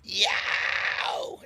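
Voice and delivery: high unvoiced scream on Yow!; fry scream. Shown as a demonstration. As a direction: rasp out a high breathy Yow!. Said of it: "fry scream that we were talking about earlier".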